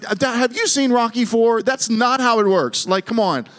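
put-on high-pitched, exasperated teen objecting to Dad; comic retold disbelief, pitched high; incredulous protest. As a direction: protest like an exasperated son, voice shooting up. Teen self protests dad's bribery plan.

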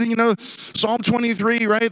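normal talking voice

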